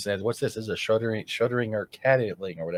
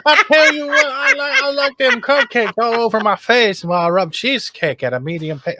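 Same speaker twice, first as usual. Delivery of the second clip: put-on Southern accent; 12 semitones higher, 7 dB louder than usual. Guest clowning for the host, hammed-up Southern accent. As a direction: go big and high-pitched with a silly Southern twang. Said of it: "why do I got this Southern accent".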